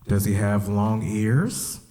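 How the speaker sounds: slow, drawn-out coy question; lightly voiced coy rabbit; coy Bugs. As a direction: drag it out playing innocent. Bugs coyly playing dumb.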